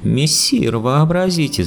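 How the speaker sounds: high, joyful exclamation; excited joyful cry. Acted. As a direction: burst out in high delight. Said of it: "закричал возбуждённый и радостно Бегемот". (Бегемот радостно кричит Воланду, высоко и возбуждённо)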